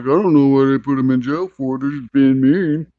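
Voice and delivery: put-on voice of a clueless complaining dad; mock dad. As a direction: imitate a dad whining, stretching the end out. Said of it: "and Dad's like".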